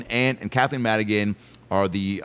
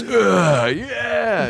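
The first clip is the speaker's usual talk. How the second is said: groan; 10 semitones higher than usual. Acted. Acting out the bit's punchline.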